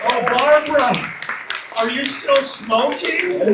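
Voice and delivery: loud, high, slow teasing; mock the guest playfully; hammy teasing voice.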